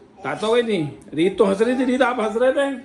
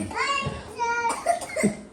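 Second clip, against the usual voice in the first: high laugh; 5 dB quieter than usual. Real, not acted. Laughs at own joke.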